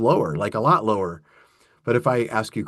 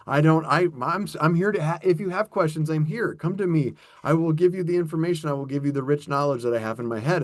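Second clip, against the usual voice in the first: higher, lightly mocking know-it-all boss; self-important manager; 7 semitones higher than usual. Retelling a manager who insists he answers everything, mock pompous. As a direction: play up his pride in being the answer man. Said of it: "And he said".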